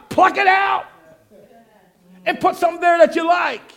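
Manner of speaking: loud fired-up preaching; preaching shout. Real, not acted. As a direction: drive it home to the congregation. Preacher exhorting congregation.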